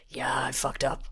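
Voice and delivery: low, soft mumbled admission; deflated. Retold or imitated. Traitor in the corridor after his downfall, deflated. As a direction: mimic someone sheepishly owning up, pitch dropped.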